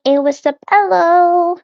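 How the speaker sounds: high sing-song little hello; cutesy hello. Pretend. Streamer greeting chat in a cutesy high voice. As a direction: chirp it like a tiny cartoon girl. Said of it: "I'm like".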